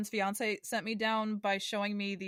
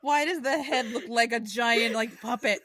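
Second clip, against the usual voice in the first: louder, slow words through real laughter; laughing; 5 dB louder, slower than usual. Real, not acted. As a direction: laugh while asking in disbelief. Laughing at the movie poster.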